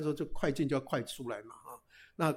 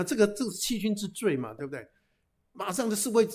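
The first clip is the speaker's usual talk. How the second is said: ordinary delivery, nothing marked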